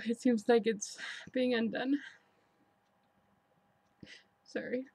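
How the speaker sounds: holding back real tears; crying. Real, not acted. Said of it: "I'm gonna cry".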